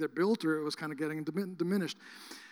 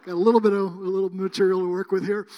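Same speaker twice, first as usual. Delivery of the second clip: loud joking; 10 dB louder than usual. Real, not acted. Joke to congregation.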